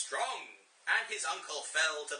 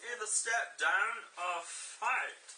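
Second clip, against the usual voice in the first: high, slow, firm challenge; hammed-up royal command; commanding Simba; 7 semitones higher, 3 dB louder, slower than usual. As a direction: lay down the ultimatum slowly. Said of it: "he commanded".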